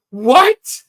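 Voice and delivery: sudden high, loud what; surprised what. Real, not acted. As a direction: jolt of disbelief. Disbelief at another character name.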